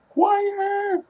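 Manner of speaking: choir, sung up high in a put-on voice; high correction. Shown as a demonstration. Self-correcting the word, squeaked up high. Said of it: "I've got a choir rehearsal".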